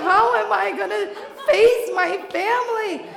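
high, loud, laughing panic; hammed-up hysterical outburst; frantic rant.

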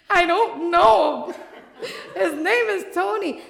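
high, loud, laughing panic; hammed-up hysterical outburst; frantic rant.

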